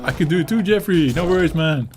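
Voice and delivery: high, bright-eyed, eager helper voice; mock YouTube voice. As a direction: play YouTube as an overeager buddy cutting in. YouTube personified offering to help.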